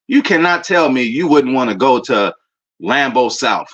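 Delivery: usual speaking voice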